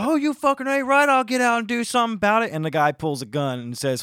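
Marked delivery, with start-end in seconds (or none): 0.0-2.5 loud, high imitated road rage; belligerent driver. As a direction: accept the fight at a near shout. Acts out driver accepting fight.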